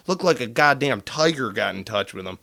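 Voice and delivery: ordinary talk, nothing marked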